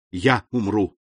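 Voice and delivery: understated, firm resolve. A narrator's reading. Fernand vowing to die for Mercédès, firmly resolute. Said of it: "продолжал Фернан с твёрдой решимостью".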